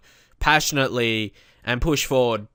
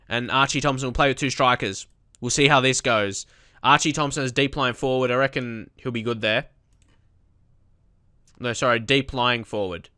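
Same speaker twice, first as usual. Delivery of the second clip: ordinary talk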